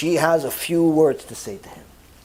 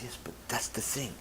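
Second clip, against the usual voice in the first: hushed whisper; 6 semitones lower, 18 dB quieter than usual. Retold or imitated. The rabbi whispers his point to the wife. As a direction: whisper it, voice dropped.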